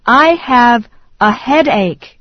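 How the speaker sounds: ordinary talk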